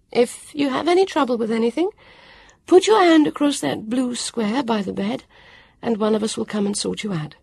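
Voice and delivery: a kind host explaining; Petula, helpful. A narrator's reading.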